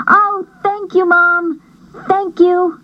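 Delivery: put-on high thrilled girl voice; grateful girl. Julie thanking her mom for the chickens, high and thrilled. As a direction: gush thanks in a high happy voice.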